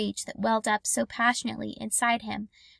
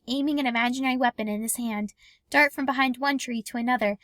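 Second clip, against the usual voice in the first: ordinary delivery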